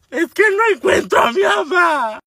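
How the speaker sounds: lamento agudo y lento, casi gritado; suéltaselo al policía lloriqueando fuerte; llanto desesperado actuado. Retold or imitated. Le llora a la patrulla. (chillido lloroso exagerado)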